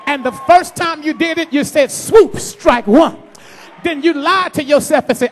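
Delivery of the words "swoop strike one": slow strike call. Acted. Sermon echo of the strike.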